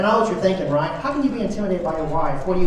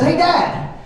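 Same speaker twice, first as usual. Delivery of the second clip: higher, slow, deadpan roast; teasing child; 8 semitones higher, slower than usual. Retold or imitated. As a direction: deliver the kid's insult slowly and innocently. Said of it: "and he says".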